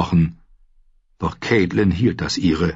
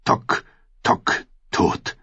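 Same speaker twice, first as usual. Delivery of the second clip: slow drumbeat; 4 dB quieter, much slower than usual. A narrator's reading. Drum booms through the hall, slow heavy beats. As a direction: toll each word like a drum. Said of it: "Die Trommel dröhnte langsam und volltönend".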